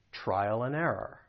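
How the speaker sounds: AND shrunk to "n" in trial and error; reduced AND. Shown as a demonstration. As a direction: stress the first syllable of ERROR. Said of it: "I'm gonna reduce it".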